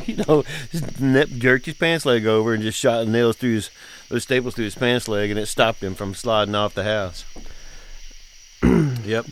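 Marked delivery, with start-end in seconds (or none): none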